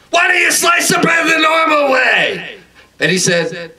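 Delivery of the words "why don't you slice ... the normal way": very high, sustained comic yell; shouted complaint. Acted. Customer to Subway worker, exasperated high-pitched shout. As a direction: yell it on one high note, dropping at the end. Acted outburst at sandwich shop worker.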